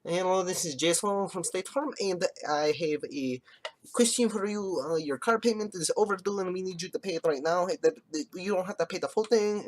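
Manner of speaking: high put-on sales caller voice; a chirpy, pushy agent pitched well up; State Farm caller. The State Farm guy chasing a payment, high and chirpy.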